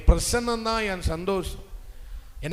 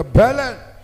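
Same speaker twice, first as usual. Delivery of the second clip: full-blast shouted word; loud demonstration; 3 semitones higher, 15 dB louder, much slower than usual. Shown as a demonstration. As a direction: belt it out for the congregation to echo. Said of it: "அந்த பலனை சத்தமா சொல்லுங்க". (மிகச் சத்தமாக முழங்கி)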